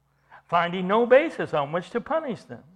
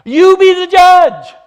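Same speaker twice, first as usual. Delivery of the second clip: loud, high, slow and forceful; emphatic challenge; 12 semitones higher, 15 dB louder, slower than usual. Retold or imitated. Peter and John challenging the council, loudly emphatic. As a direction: throw it back at them, every word drawn out. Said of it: "Peter and John are being emphatic to them".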